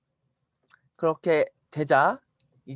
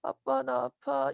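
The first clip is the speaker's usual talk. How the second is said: put-on higher, slow child voice; drawn-out complaint of an ailing little boy; sick child; 7 semitones higher, much slower than usual. Sick son tells his father he hurts, slow childlike whine.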